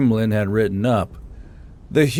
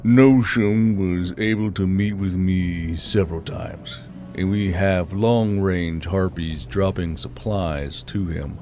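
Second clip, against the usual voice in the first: lower, softer traveler's report; a hint of a low weary scout; Gruesome's voice; 5 semitones lower, 5 dB quieter than usual.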